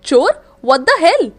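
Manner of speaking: loud, disbelieving outburst; shocked outrage. Acted. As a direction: blurt it out, stunned and offended. Boy grabbed and called thief.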